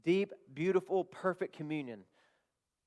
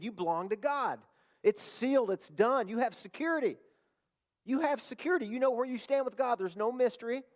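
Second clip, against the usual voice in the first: preaching at full force; 5 semitones higher than usual. Acted. Preacher to his church, proclaiming at full force. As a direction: drive it home to the congregation.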